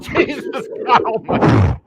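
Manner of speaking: high, loud laughing exclamation; shocked laughter. Real, not acted. Host watching a kangaroo fight, laughing in disbelief. Host reacts to violent video clip.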